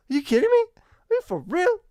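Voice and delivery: high, incredulous country-accent outburst, mildly put on; a light country twang, acting floored by the scan result. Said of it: "Did Eskel just do a country accent?".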